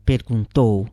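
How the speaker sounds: plain reading voice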